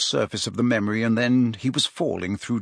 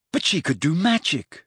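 mildly impatient objection, higher-pitched; 6 semitones higher than usual. A narrator's reading. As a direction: protest with a touch of impatience. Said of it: "said Harry impatiently".

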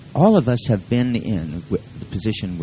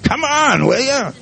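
impatient dog, very high and loud; barking dog talk; 4 dB louder, slower than usual. Acted. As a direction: bark the words at a slowpoke human. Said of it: "they're barking".

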